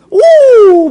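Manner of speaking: high loud "uuuh"; long high-pitched delighted whoop; hammed-up whoop of greeting; excited whoop. Whoop on meeting a friend.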